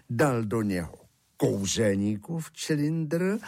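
usual speaking voice

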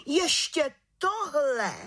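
high, drawn-out outrage; shocked exclamation. Acted. Head witch reacts to the aunt's accusation, high scandalised cry. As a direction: stretch it out, aghast. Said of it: "vykřikla vrchní čarodějnice".

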